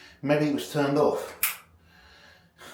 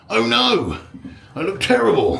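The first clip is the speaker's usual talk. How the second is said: dismayed; 7 semitones higher, 5 dB louder than usual. Real, not acted. Dismayed at his reflection at night.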